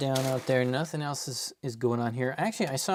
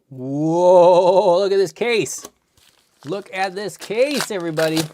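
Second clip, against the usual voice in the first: louder, delighted showing off; excited; 4 semitones higher, 10 dB louder than usual. Real, not acted. Streamer shows the case to viewers, excited. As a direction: show it off proudly.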